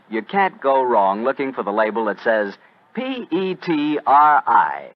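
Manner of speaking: ordinary talk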